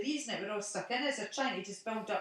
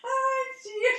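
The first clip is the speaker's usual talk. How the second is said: much higher, laughing it out; laughing sigh; 8 dB louder than usual. Real, not acted. Recovering from laughing at the friend's joke, laughing. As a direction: breathe out the last of the laugh.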